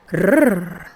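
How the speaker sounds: a lightly voiced beast's growl; monster growl.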